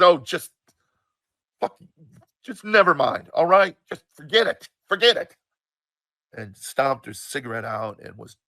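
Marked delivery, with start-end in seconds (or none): 0.0-5.4 loud, higher exasperated outburst; angry mother. Retold or imitated. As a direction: imitate a mother giving up in anger. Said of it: "a little angry".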